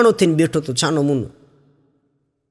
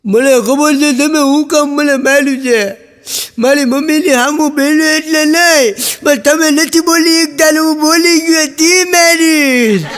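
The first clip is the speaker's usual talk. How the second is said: high, whining mock sobs of a scolded boy; crying boy's whine; 7 semitones higher than usual. Boy cries to his father after a beating, mock whining voice. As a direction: whimper it like a beaten kid, pitch way up. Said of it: "ઓલો છોકરો રડતા રડતા".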